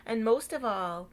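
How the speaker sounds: plain reading voice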